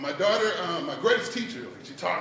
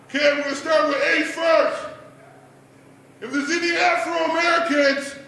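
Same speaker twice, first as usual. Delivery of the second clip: high, loud declaiming pilot voice; pilot's announcement; 8 semitones higher, 5 dB louder than usual. Acted. Pilot ordering passengers off overloaded plane, shouting.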